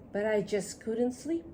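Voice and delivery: ordinary delivery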